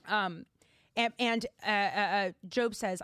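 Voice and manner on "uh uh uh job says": ordinary delivery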